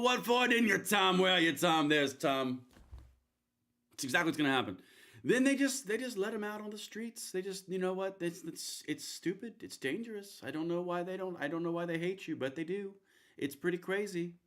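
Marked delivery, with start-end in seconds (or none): 0.0-2.6 higher and louder, hammed-up jab; mock Trump boast. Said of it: "gave him the old". 5.3-14.3 higher, mock-earnest rally voice; Trump impression. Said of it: "let me know how I'm nailing this".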